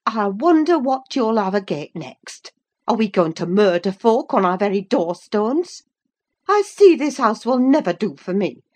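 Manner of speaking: high, loud dialect tirade; scolding housewife. A narrator's reading. Housewife scolding the household over the choking visitor, loud. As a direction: let fly as an indignant housewife. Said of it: "she turned her vocal artillery".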